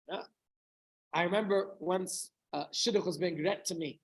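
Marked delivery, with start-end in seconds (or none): none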